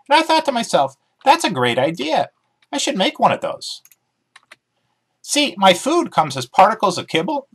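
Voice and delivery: chirpy dog character, keen to explain; eager talking dog. Acted. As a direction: bounce through it like an excited dog. Dog Emmy voiced in story.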